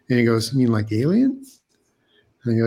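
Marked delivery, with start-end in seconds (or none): 0.5-1.4 put-on a light European accent rising on alien; imitated European accent. Sponsor on the phone asking about the object, in a light European accent. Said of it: "in this thick European accent".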